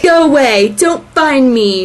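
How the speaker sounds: imitating a forced statement; mock recital. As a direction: call it out slowly, overdone. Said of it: "he's all like".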